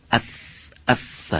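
اث with a long, continuous "th" hiss; unbroken tha sound. Shown as a demonstration. Teacher showing how the letter carries through, unbroken sound. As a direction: hold the ث in اث without a break. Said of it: "وسط حرف قطع نشه".

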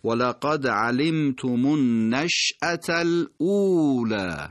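slow ولقد علمتم النشأة الاولی with the vowels drawn out; slow Quranic recitation; careful recitation. Shown as a demonstration. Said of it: "حتماً این حرکت کشیده".

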